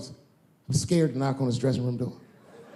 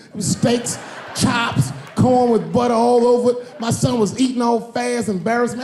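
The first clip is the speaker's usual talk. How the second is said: excited list; 8 semitones higher, 4 dB louder than usual. Real, not acted. Excited listing of the food spread.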